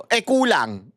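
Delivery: ordinary talk, nothing marked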